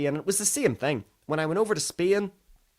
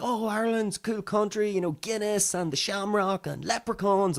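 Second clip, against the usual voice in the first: higher-pitched, lightly mocking quote; 6 semitones higher than usual. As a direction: lightly imitate a gushing foreigner. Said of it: "there's all of these stereotypes".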